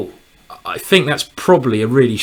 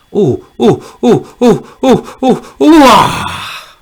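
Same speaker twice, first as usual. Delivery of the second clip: rising higher and louder oh oh; excited oh oh; 9 semitones higher, 6 dB louder, much slower than usual. Real, not acted. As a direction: build the excitement to a cry. Excited over his rook move.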